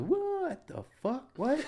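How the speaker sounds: very high, loud, stretched-out mock disbelief; baffled heckle. One guy answers the comedy question, mock bafflement. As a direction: drag out the first words in loud disbelief.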